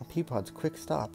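usual speaking voice

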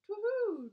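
high, loud owl hoot. Acted. Owl hoots.

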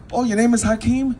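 high mock-curious questioning; nosy greeter. Imitating someone asking about the name Hakeem, high and curious.

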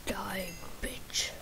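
unvoiced menacing whisper. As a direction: hiss it under your breath. Whispered during fight.

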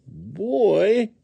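high questioning critter voice; puzzled woodchuck. A narrator's reading. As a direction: ask it high, baffled.

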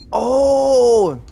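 loud startled cry; frightened cry. Real, not acted. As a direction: yelp in fright. Alien appears, cry out.

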